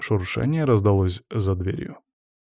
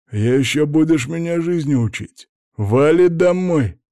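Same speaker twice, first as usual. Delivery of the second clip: loud, higher, dragged out; angry yell; 5 semitones higher, 5 dB louder than usual. A narrator's reading. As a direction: shout him out the door. Thug yells at narrator.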